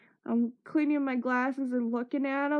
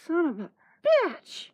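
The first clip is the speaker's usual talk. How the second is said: higher, yelled curse after a fall; pained curse; 5 semitones higher, 3 dB louder than usual. Retold or imitated. Knocked to the floor by Chris, she curses higher and louder. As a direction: swear out loud as you hit the ground.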